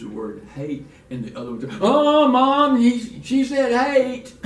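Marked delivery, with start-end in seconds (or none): 1.8-4.3 put-on much higher, louder child voice tattling to Mom; tattling little girl. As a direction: squeal it like a shocked little daughter. Father voices daughter tattling at table.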